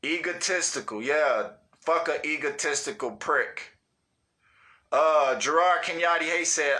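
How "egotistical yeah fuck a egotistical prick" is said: ordinary talk, nothing marked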